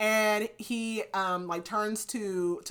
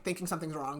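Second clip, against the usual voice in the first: normal talking voice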